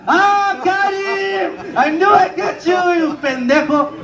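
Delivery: high, very slow triumphant crow, then a shout; gloating dad. Retold or imitated. Father gloating that he fooled his son, triumphant shout. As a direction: crow over the win.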